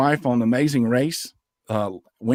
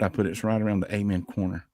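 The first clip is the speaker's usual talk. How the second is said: ordinary talk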